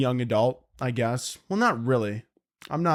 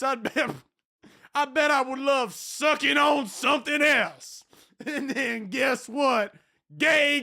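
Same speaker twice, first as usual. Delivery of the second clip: high, slow, booming Southern preacher voice; hammed-up Southern preacher sermon, shouting on the big lines; 11 semitones higher than usual. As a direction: rail against sin from a Southern pulpit, drawing the words out. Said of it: "let me get my Southern here".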